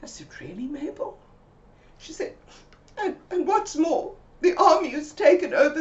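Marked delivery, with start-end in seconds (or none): 0.4-1.2 soft whispered really; whispered doubt. Retold or imitated. Sceptical whisper. 3.0-5.8 high, loud, breathless worried account; high panicked Mabel relaying the news; emotional friend. Retold or imitated. Mabel goes on.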